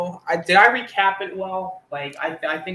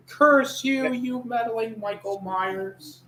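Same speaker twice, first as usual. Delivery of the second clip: high, drawn-out cartoon curse; hammed-up cry of a foiled villain; mock cursing; 7 semitones higher, slower than usual. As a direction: wail the curse slowly, high-pitched. Mimics a foiled character's unheard curse.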